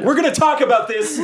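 high-pitched scolding holler; yelling from afar. Acted. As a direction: yell it across a widening distance. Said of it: "now I'm yelling 'cause we're further away".

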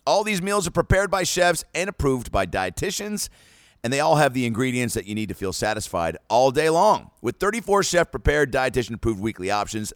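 lively sponsor read to listeners; casual podcast ad read, energetic; upbeat ad read. Acted. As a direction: sell it like a friendly host pitch. Host reads a sponsor ad.

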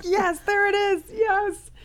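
very high, slow, drawn-out delighted yes; thrilled squeal of recognition, retold; jaw-dropped excitement. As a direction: exclaim it way up high, stretching it out. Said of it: "my jaw dropped".

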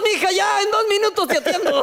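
high, laughing put-off reply; father laughing while answering his daughter; high mock father. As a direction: call out high through laughter. Father answering daughter mid-sex.

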